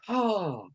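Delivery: single open-mouthed "Ha" as the shoulders drop. Shown as a demonstration. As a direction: let one loose ha fall out of a wide mouth. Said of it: "wide open mouth going".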